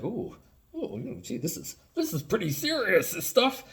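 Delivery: high, put-on naive voice; daunted amateur. Toy nerd faced with production questions, high daunted voice. Voicing the overwhelmed would-be toymaker.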